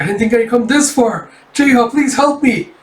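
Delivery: hammed-up panicked cry for help; mock screaming panic. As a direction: beg for rescue in pretend terror. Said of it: "you hear him just screaming".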